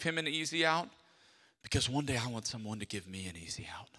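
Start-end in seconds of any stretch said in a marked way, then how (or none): 1.6-3.9 low quick whisper; whispered confession. Acted. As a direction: confide it under your breath.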